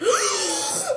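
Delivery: high, shocked intake of breath; sharp gasp. Retold or imitated. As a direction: gasp as if caught off guard. Reacting to a cheating text.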